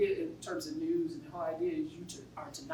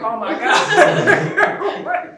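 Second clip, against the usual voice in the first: slow, loud, laughing disbelief; amazed laugh; 9 semitones higher, 19 dB louder, much slower than usual. Retold or imitated. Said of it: "we were like".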